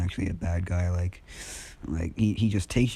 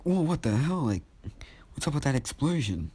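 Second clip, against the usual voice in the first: higher-pitched, incredulous exclamation; startled reaction; 7 semitones higher than usual. Retold or imitated. Onlookers react to the explosion, startled. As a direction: act out a shocked onlooker.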